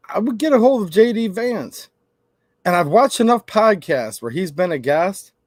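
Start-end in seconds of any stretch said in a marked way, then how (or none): none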